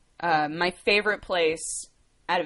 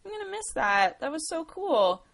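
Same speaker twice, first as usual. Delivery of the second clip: wistful excitement; 10 semitones higher than usual. Retold or imitated. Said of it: "I was like".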